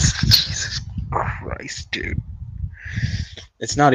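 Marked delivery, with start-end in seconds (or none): 0.1-2.2 whispered disbelief. Real, not acted. Laughing disbelief at the analogy.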